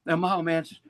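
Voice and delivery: usual speaking voice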